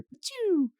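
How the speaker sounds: high squeaky zap noise; laser zap sound. Retold or imitated. Imitating Rigby's laser blast, a squeaky zap.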